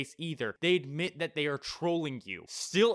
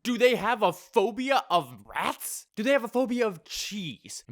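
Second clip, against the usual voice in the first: baffled questions; 8 semitones higher, 6 dB louder than usual. Real, not acted. Baffled questions about the story.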